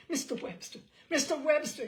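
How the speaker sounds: fearful, urgent plea building to a loud cry; a frightened man begging with desperate courage; shaking with fear. A narrator's reading. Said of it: "his voice was shaking with fear and a desperate courage".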